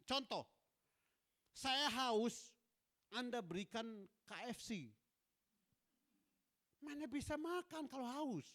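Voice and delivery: high, insistent sermon delivery; animated preaching. Real, not acted. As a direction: drive the example home to the congregation. Preaches a point with examples. (khotbah bersemangat bernada tinggi)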